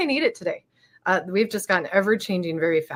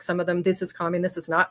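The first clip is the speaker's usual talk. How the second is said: normal talking voice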